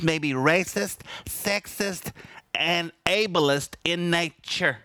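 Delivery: a hint of a sanctimonious bureaucrat; mocking prissy voice. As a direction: lightly mimic a prim university official. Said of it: "that's how they said it, just like that".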